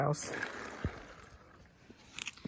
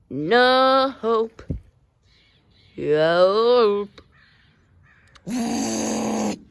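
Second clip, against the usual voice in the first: dramatic wail; 4 semitones higher, 5 dB louder, much slower than usual. Acted. Dramatic lament.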